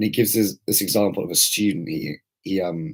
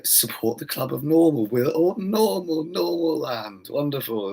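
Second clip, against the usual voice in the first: mock celebration; 6 semitones higher than usual. Ranting parody of conformists' club slogan, mock celebration. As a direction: stretch it out in sing-song mock delight. Mocking conformists cheering for normality.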